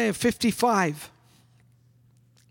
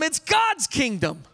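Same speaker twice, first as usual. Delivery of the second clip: normal talking voice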